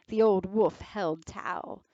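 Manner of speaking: usual speaking voice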